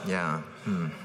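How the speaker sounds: low, bored grunts of agreement; lightly voiced absent-minded husband; fake listening. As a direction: pretend to listen without caring. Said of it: "every ten seconds just go 'Uh-huh.'".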